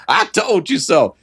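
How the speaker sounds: high singsong mock gloat; gloating I told you so. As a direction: rub it in like a smug kid. Mocking the gloating kid.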